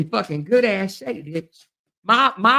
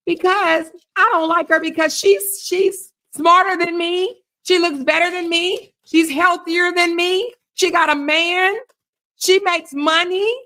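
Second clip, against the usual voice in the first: mocking a rival; 7 semitones higher than usual. Streamer voicing what her rival should say, mocking. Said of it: "you can talk like this".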